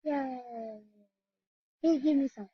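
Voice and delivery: usual speaking voice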